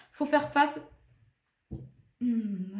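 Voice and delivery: holding back a hiccup; talking through hiccups; suppressing hiccups. Real, not acted. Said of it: "pardon, j'ai le hoquet".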